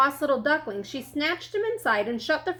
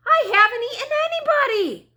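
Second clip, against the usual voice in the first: high, loud, fast wail; howling protest; 12 semitones higher, 6 dB louder, faster than usual. A narrator's reading. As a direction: howl your innocence through a shut door. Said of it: "he howled".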